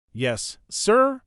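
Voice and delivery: "Yes, sir?" lifted high at the end, asking; unsure reply, rising on "sir"; puzzled question. Acted. Bud unsure, answering as question.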